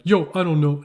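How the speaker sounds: loud mock street-talk kid; cocky kid's yo. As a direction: toss out a brash, imitated "yo". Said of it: "I was saying yo a lot".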